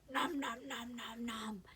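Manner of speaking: playful nom-nom eating noises, run together; munching book character. Acted. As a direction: chomp through the words for a baby. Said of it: "books like this".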